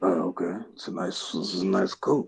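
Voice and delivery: low, soft imitation of a hobo; Batman Begins hobo. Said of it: "hobo in Batman Begins".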